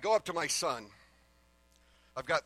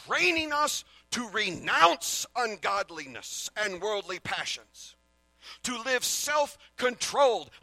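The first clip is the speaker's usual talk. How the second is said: slow, emphatic Bible reading, rising louder; scripture reading; 4 semitones higher, 6 dB louder, slower than usual. Acted. Preacher reading Titus to the congregation, slow and emphatic. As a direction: proclaim the verses to the church. Reads scripture passage aloud.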